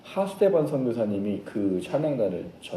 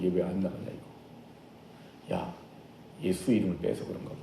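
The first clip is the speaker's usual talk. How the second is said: hushed conferring; 6 semitones lower, 11 dB quieter than usual. Acted. Disciples conferring after failing.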